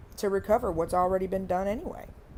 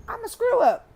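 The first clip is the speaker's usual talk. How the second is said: mock confession; 13 semitones higher, 7 dB louder than usual. Self-mocking confession.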